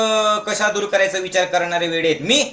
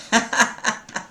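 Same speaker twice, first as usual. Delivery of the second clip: short chuckle at own joke; laugh; 3 semitones higher, 4 dB quieter than usual. Real, not acted. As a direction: laugh at your own madness. (हसणे)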